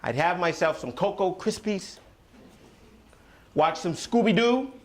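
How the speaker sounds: usual speaking voice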